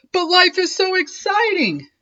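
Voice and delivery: high, bright shark voice; excited. A narrator's reading. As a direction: gush about how exciting life is. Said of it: "said Clark".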